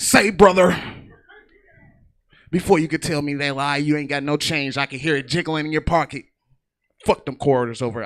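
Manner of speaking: loud gruff hustler voice punched out; imitating homeless guy. Comic to audience, homeless guy demanding change, loud and pushy. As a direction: bark it like a wrestler hustling change.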